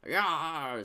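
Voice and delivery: loud salty pirate growl; sea captain. Acted. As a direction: bellow it like an old sailor. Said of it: "said the sea captain".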